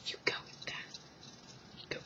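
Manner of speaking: whispered mock encouragement; sarcastic whisper. Real, not acted. Vlogger mocking a boy's excuse, sarcastic whisper. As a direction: hush it out sarcastically. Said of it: "sarcastic aside".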